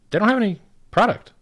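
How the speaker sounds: sudden loud, high indignant burst; angry outburst. Real, not acted. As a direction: blurt it out in real outrage. Ranting about crypto critics.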